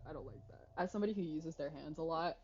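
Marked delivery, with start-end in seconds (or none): 0.1-0.7 softer, trailing off. Real, not acted.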